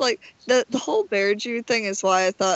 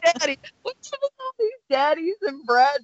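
higher, playful mocking tone; teasing; 7 semitones higher than usual. Real, not acted. Teasing her co-host about Brad Pitt roles, playful mock. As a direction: tease the daddy nickname.

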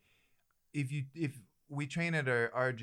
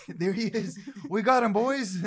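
excited call-out; 8 semitones higher, 7 dB louder than usual. Real, not acted. Spotting the lookalike, calling out.

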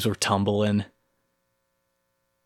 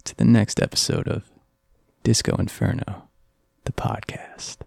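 breathy, close-mic radio host; creepy late-night host intro. Acted. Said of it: "the close-up late-night D J voice on the mic".

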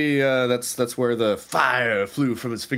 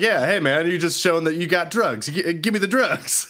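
mock demand; 6 semitones higher than usual. Jokingly demands drugs from hobo, retold.

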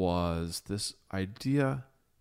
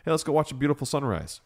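higher, bright invitation; mock cheerful; 7 semitones higher, 4 dB louder, faster than usual. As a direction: sell the sunrise, overly upbeat. Mock suggestion, excited sunrise invitation.

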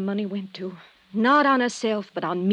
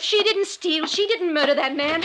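distraught outburst; 8 semitones higher than usual. Acted. Woman confesses to the detective, distraught outburst. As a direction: wail the confession out, losing control.

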